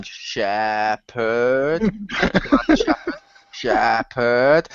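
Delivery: hammed-up, long-drawn name; slow, low, exaggerated drone; played-up greeting. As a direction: stretch both syllables out absurdly in a low voice. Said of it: "play it up more by going".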